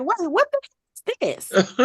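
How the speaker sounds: high, baffled outburst mid-narration; mock bewilderment, voice jumping up; startled narrator. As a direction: imitate a narrator thrown off, pitch leaping. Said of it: "the Watcher is sitting there giving his, like, narration".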